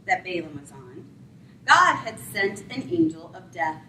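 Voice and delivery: normal talking voice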